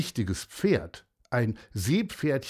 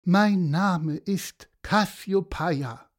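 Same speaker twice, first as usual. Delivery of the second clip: higher, slow, lightly voiced horse; Cassiopeia, woeful; 6 semitones higher, slower than usual. A narrator's reading. Weeping horse introducing itself, slow and mildly woeful. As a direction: draw it out, a touch pitiful.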